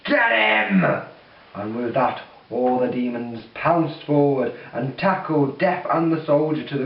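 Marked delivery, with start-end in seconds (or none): 0.0-0.8 loud, high roared command; furious scream. Acted. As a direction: roar the attack order. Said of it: "with a furious roar, the big demon screamed".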